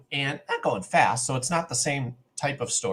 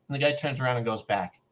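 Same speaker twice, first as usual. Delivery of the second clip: ordinary delivery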